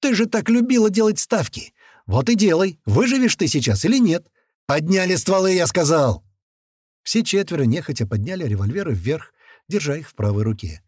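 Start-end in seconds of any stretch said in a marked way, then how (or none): 0.0-6.2 high, raised-pitch goading; angry, commanding young gunman; angry taunting. A narrator's reading. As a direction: taunt her, then snap an order. Gunman taunts woman, then orders.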